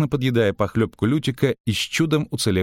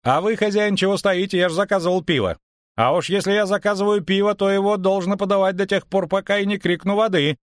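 high-pitched, insistent bard; imperious, pitched well up; demanding bard; 12 semitones higher than usual. A narrator's reading. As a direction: order the innkeeper about in a high voice. Lyutik orders beer in story.

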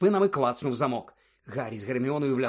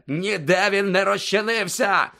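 loud, slow, raised bellow; furious roar; 6 semitones higher, 6 dB louder, slower than usual. A narrator's reading. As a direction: roar each word in rage. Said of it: "заревів голос Снейпа". (лютий повільний рев)

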